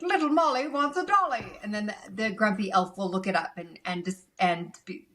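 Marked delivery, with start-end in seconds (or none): 0.0-1.5 a lightly voiced jolly Santa, higher and reading a letter. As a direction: read the child's wish as a cheery Santa. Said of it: "And he's like".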